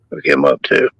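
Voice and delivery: usual speaking voice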